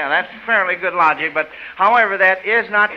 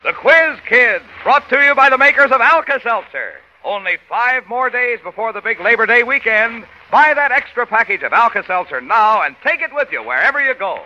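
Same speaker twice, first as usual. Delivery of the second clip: put-on old radio announcer; 4 semitones higher than usual. Old radio sponsor announcement and intro.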